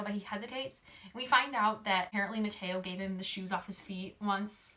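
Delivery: ordinary talk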